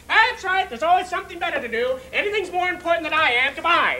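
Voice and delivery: loud, high, wounded sarcasm; offended, high-pitched parting shot; huffy mother. Acted. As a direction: fire off the grievance and sign off. Mother's huffy sign-off before hanging up.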